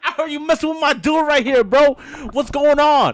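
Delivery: high, loud mock challenge; acted confrontation. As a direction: call him out loudly, hammed up for the story. Retold confrontation, defending his friend.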